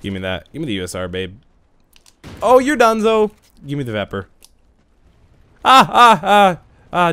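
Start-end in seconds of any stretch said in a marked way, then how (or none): 2.4-3.3 taunting cheer. Real, not acted. Taunting after kill. 5.6-7.1 high, loud panicked yelps; short, sharp, high-pitched panicked cries; startled cries. Real, not acted. Screen shaking, startled.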